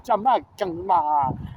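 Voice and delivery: drawn-out put-on Jamaican accent; imitated Jamaican lilt; mock Jamaican accent. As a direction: say it like a hammed-up Jamaican. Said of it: "There's all the Haitians in the".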